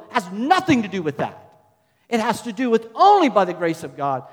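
loud, emphatic sermon build; full-force preaching. Real, not acted. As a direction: hammer each phrase out loudly to the congregation.

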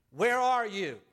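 put-on high, loud parent calling for a child. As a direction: call out like a worried parent. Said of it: "you heard their voice saying".

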